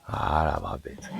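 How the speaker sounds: low, very soft, gentle praise; soothing praise. Acted. Player softly praising the horse, low and gentle. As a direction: murmur praise to the calmed horse.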